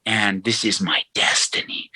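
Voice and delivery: whispered, mocking line of a true believer; whispered mock destiny vow; mock hushed vow. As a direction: breathe it like a sacred oath. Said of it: "You believe".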